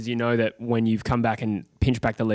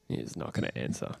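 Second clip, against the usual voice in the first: whisper; 12 semitones lower, 11 dB quieter than usual. Real, not acted. Repeated line, dropped to whisper.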